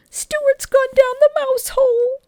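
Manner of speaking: high, loud, slow sobbing wail; wailing mother. Acted. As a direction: wail it out in despair. Mother wails in distress.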